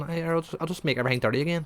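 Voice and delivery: very high-pitched pleading; hammed-up high, alarmed voice; mock panicked protest. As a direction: imitate a guy frantically trying to stop someone. Acted panicked protest in a retold scene.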